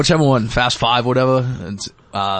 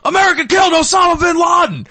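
put-on high, loud drunken yell; drunk scream; 4 dB louder than usual. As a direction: belt it out like a drunk cheering fan. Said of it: "he just screams out of nowhere".

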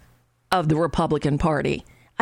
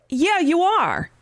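sarcastic retort; 11 semitones higher, slower than usual. Real, not acted. Retort to quoted co-host.

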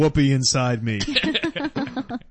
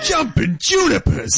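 put-on old-timey adventurer cry; 13 semitones higher, slower than usual. Mocking 1930s treasure hunter.

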